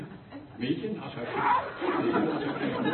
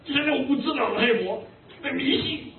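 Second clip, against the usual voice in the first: imitating an angry scold, higher and louder; 10 semitones higher, 3 dB louder than usual. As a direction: replay the pastor's angry rebuke, pitched up. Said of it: "他很生气".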